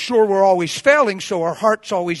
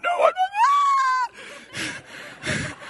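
very high mock-tearful protest; imitated wail. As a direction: squeal it like someone crying. Imitates her crying denial.

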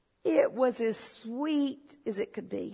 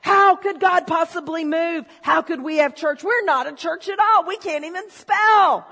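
loud, higher, hammed-up despair, laughing at the end; mock outrage; 5 semitones higher, 10 dB louder than usual. To the congregation, mocking his old self, loud mock despair. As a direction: overplay the tragedy of a typo.

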